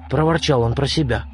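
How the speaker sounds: ordinary delivery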